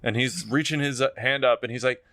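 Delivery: normal talking voice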